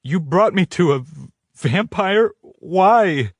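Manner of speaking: high, rising shock; disbelieving and upset. A narrator's reading. Her shocked questions.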